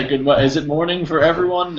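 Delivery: ordinary talk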